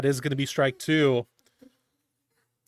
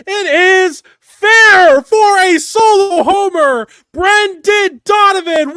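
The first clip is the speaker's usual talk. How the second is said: screaming at the top of his lungs, much higher and louder; home run scream; 10 semitones higher, 11 dB louder than usual. Real, not acted. Fan screaming the home run call to viewers. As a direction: lose your mind over a leadoff homer. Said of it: "Sorry that I scared you with my screaming".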